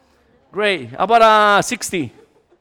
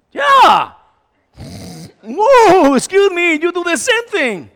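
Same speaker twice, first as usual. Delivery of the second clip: loud, higher mock protest; indignant spouse; 7 semitones higher, 8 dB louder, slower than usual. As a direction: fire back at your spouse. Couple arguing about snoring.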